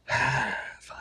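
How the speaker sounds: soft, sighed concession; mock resignation. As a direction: give in with a big breathy sigh. Mock giving in with sigh.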